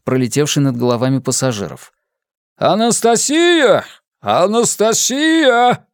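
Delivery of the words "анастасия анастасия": loud drawn-out male call across a crowd; booming call. A narrator's reading. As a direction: call the name out long and loud. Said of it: "зычный мужской голос".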